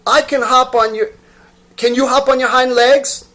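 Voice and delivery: high, loud taunt; challenging wild rabbit. Acted. Wild rabbit daring the toy rabbit, high and loud. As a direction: dare him.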